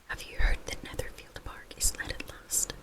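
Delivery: ordinary delivery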